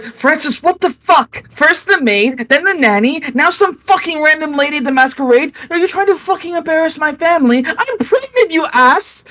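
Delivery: high, shrill-pitched tirade at her husband; furious wife. Acted. Pregnant wife screaming at Francis at the masquerade, furious and high. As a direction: rip into him, voice way up.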